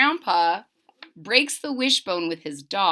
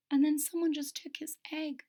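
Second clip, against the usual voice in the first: hushed whisper; 5 semitones higher, 9 dB quieter than usual. A narrator's reading. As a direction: whisper the twist. Whispered suspense.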